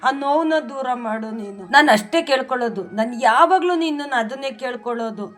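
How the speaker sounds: pleading with god, heartfelt and steady; earnest prayer. Real, not acted. Devotee praying to Rayaru for her family, earnest pleading prayer. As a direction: pour your heart out to the deity in prayer.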